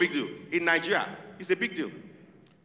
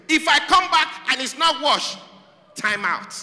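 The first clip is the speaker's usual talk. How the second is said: mild American mom threat; 8 semitones higher, 4 dB louder than usual. Retold or imitated. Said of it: "in America, they let you know your punishment".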